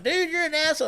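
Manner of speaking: friend's retort. Retold or imitated. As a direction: fire back as the annoyed friend. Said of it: "And he's like".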